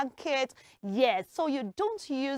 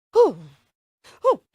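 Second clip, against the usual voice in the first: short, sharp puffed "whew"; acted cold gasp; shivering puff; 9 dB louder than usual. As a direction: blow out breath as if freezing.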